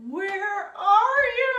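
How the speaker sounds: high, loud, slow pleading cry; wailing where are you; desperate cry. Retold or imitated. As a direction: cry out for him in labour. Retelling desperate labour plea.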